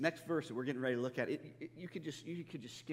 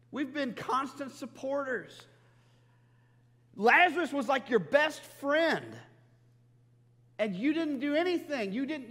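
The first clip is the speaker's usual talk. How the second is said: higher and louder, hurt and accusing; angry outburst; 9 semitones higher, 7 dB louder than usual. Retold or imitated. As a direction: pour out the grievance at a friend who failed you. Martha's imagined angry thoughts.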